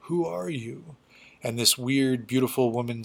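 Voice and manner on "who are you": a faint, high, hesitant stammer; half-conscious, barely getting the words out; weak stammer. Retold or imitated. Half-conscious Wolverine stammers at the specter, faint and high. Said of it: "just manages to stammer out".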